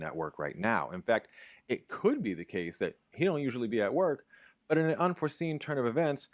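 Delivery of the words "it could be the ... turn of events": rising excited example. Shown as a demonstration. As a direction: pitch climbing to high. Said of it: "example of deleted copula".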